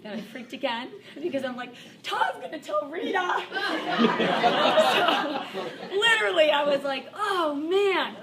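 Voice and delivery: laughing as she admits she freaked out; laughing through panic. Real, not acted. Laugh loudly through the words.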